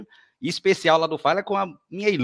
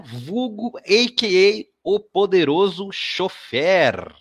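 slow, loud, higher-pitched ring announcer build; announcer-style introduction; 6 semitones higher, 4 dB louder, much slower than usual. Real, not acted. Host presenting guest Thiago by his nickname, announcer-style. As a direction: draw out the grand nickname like an emcee.

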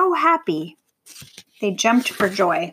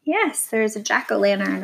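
usual speaking voice

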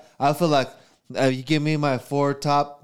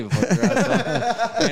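mocking drawn-out voice; 8 semitones higher, much slower than usual. Mocking voice after laughing.

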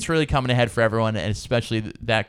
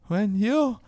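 put-on high, slow, soft childlike pleading voice; Asuelu impression; 14 semitones higher, 3 dB quieter, slower than usual.

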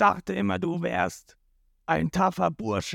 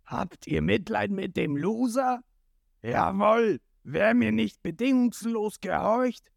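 high, strained, scornful tyrant; gloating villain; 7 semitones higher than usual. A narrator's reading. Krieg mocking Jin to his crew, high villainous gloating. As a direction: sneer at the loser, rising toward the final order.

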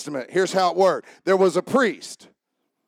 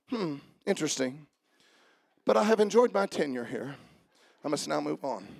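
mock unemotional; 6 semitones lower, 9 dB quieter than usual. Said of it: "Oh, you're not emotional".

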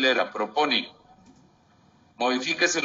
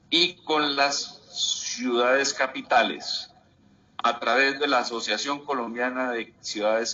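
deliberate restatement. Real, not acted. Repeats added clause for the record.

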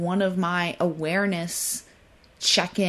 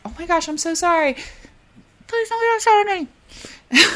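high, fast, pleading and louder at the end; frantic apologizing; 11 semitones higher, 4 dB louder than usual. Retold or imitated. Inner voice of a people-pleaser begging someone not to be upset. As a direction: panic over maybe upsetting someone.

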